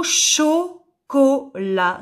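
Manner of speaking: slow "chocolat" in three separate beats; syllable by syllable. Shown as a demonstration. Teacher setting a syllable game, "chocolat" broken syllable by syllable. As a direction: pause between the syllables of "chocolat". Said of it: "si je supprime la première syllabe".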